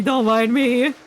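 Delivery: ordinary delivery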